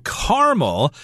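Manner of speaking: "caramel" as KAR-mul, middle vowel dropped; two-syllable caramel. Shown as a demonstration. Host shows the word he grew up saying, "caramel" as KAR-mul. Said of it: "I grew up saying caramel".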